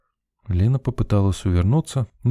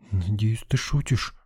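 frightened squeak; 2 semitones higher, 6 dB quieter than usual. A narrator's reading. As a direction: squeak it out softly, scared. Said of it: "пискнула Лена".

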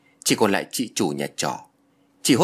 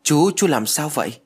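higher, anxious cry of alarm; frightened child; 6 semitones higher, 4 dB louder than usual. A narrator's reading. Scared little girl to the stricken man, frightened. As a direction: call to him in worried fright. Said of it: "nó hoảng sợ".

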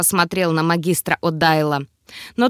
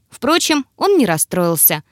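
ordinary delivery

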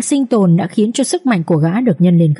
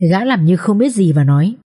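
ordinary delivery, nothing marked

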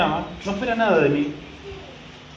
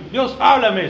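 raised, louder mock cry to heaven; mock plea to God; 4 semitones higher, 6 dB louder, slower than usual. Preacher parodies someone begging God to speak, raised mock plea. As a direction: call up to the sky, half in jest.

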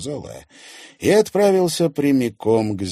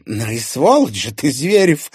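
loud, raised-pitch angry insult; understated rage, voice raised; furious exhalation; 7 semitones higher, 9 dB louder than usual. A narrator's reading. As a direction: let the insult burst out. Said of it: "выдохнула она".

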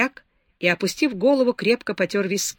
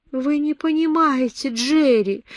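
pleading, pitch higher; 7 semitones higher, 3 dB louder than usual. A narrator's reading. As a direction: beg him to understand. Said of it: "умоляюще заговорила Беатрис". (умоляюще)

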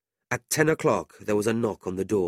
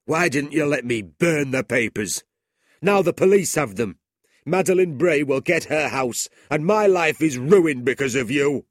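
high, loud ruined schoolmaster; jailed schoolmaster raging at his partner, raised; bitter Squeers; 7 semitones higher, 8 dB louder than usual. A narrator's reading. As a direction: spit the blame at him. Squeers blaming Ralph.

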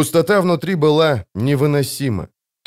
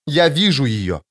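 faint note of madness; understated, eerie insistence; touch of madness; 3 semitones higher than usual. A narrator's reading. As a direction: insist with slightly unhinged certainty. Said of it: "Она услышала нотки безумия в его голосе".

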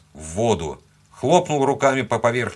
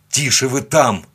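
put-on loud, higher bark at children; barked order; 4 semitones higher, 5 dB louder than usual. A narrator's reading. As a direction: bark at them to settle. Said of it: "рявкнул я на детей". (резкий окрик на детей)

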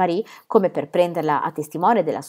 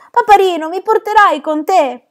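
put-on much higher, louder, pleading little boy; small child; 12 semitones higher, 8 dB louder than usual. Little Pierre pleads with his father, high childish begging. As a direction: beg like a tiny child clinging to his dad.

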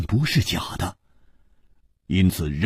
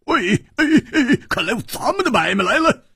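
high, gleeful calling out; hearty glee; 13 semitones higher than usual. A narrator's reading. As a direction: whoop to your mates in a high voice. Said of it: "胡铁花大笑道". (兴高采烈地高声招呼)

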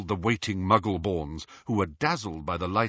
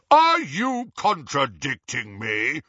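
blustering; 3 semitones higher, 4 dB louder, slower than usual. A narrator's reading. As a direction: bluster like an offended boss. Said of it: "Harry blustered".

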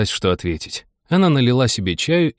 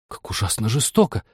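ordinary delivery, nothing marked

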